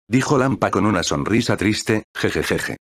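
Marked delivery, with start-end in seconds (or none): 2.2-2.8 low, quiet hehehe giggle; quiet little laugh; soft giggle. Acted. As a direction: giggle under the breath. Character giggles after remark.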